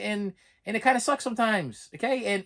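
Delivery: normal talking voice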